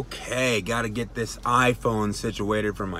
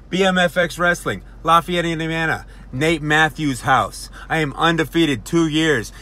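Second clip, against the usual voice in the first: loud, higher-pitched hype; wrestling promo; 4 semitones higher, 5 dB louder than usual. Acted. Champion warning the challenger for his title, loud wrestling promo. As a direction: boast loudly and challenge your rival.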